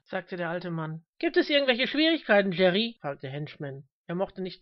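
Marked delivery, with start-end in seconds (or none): 1.2-3.0 raised, loud question; calling out. A narrator's reading. Reverend checking on his usher, calling loudly. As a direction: call across to your helper. Preacher calls across to usher.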